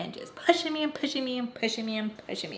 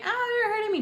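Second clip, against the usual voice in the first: retold pained cry again; 13 semitones higher than usual. Girlfriend clutching her leg in the car, high pained cry. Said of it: "I scream out and say".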